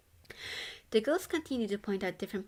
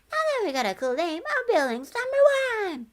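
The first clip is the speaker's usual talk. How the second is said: very high, loud ghost voice; excited ghost; 13 semitones higher, 9 dB louder than usual. A narrator's reading. Ghost bragging about the dorm, very high and loud. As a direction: crow it proudly.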